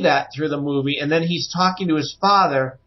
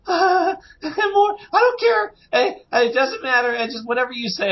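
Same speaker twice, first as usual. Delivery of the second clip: high, laughing giddy gush; giddy high-pitched gushing; giddy fanboy; 9 semitones higher than usual. Retold or imitated. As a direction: squeal like a delighted fan who'll accept anything. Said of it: "I'm like".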